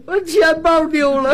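put-on high, wailing old woman's cry; 哭诉的老大娘. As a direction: sob it out in comic old-auntie despair. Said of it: "大娘说什么呢？". (老大娘夸张哭腔)

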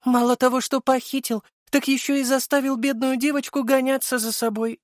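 high, hammed-up plaintive girl voice; mock-tearful, high-pitched complaint; feigned whimpering. A narrator's reading. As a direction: play the poor victim, badly faked. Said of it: "Она наигранно всхлипнула".

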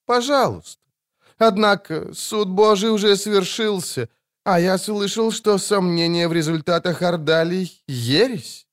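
calmly corner the priest; cool barber. A narrator's reading. Regis calm reply.

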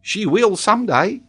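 high-pitched, solemn little pupil; serious schoolboy, high and deliberate; grave child. Acted. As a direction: answer in earnest in a slow child's voice. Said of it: "replied the young savage gravely".

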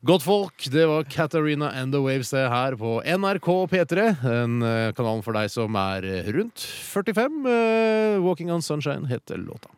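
high, projected back-announcement; hammed-up DJ voice; old-school radio presenter. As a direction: announce it grandly to the good people. Mock-formal radio greeting and back-announcement.

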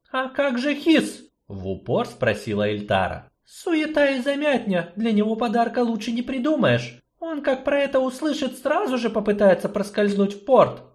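high, direct sorceress; point-blank question. Acted. Sorceress presses gnome about Hiss.

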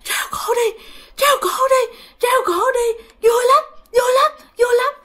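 very high, strangled near-crying voice; struggling possessed voice. Retold or imitated. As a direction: imitate a spirit labouring out each phrase. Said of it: "ông nội kể khó khăn lắm". (giọng nhập hồn rất cao, nghẹn như khóc)

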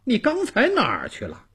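high-pitched, miffed questioning; displeased. Acted. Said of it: "老汉不高兴地问道". (不高兴地高声质问)

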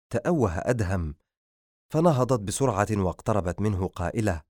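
plain reading voice

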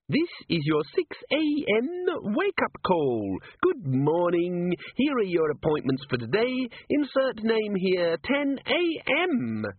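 a tiny eager imp reading the schedule; Dis-organizer imp announcing the wake-up call; chirpy imp. Acted.

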